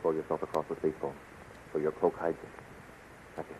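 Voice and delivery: low, soft conspiratorial instructions; hushed orders. Acted. Captain coaches his ally before the guard arrives, hushed. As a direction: give secret orders under your voice.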